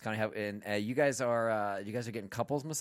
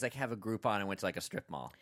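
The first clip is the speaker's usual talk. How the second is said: ordinary talk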